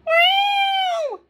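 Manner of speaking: very high, loud meow; urgent meow. Acted. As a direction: meow up high, calling for help. Max meows for help.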